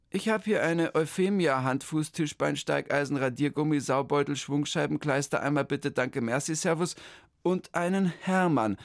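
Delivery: high, chirpy clerk voice; the clerk rattles off her greeting, high; official lady. Acted. The town-hall clerk greets Hermann, high and chirpy.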